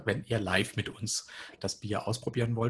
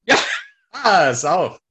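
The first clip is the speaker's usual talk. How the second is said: loud, high burst of triumph; triumphant shout; 8 semitones higher, 15 dB louder, much slower than usual. Real, not acted. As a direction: yell out in victory. Bottle finally opens. (lauter Jubelschrei)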